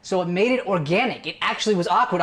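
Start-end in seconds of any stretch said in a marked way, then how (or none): none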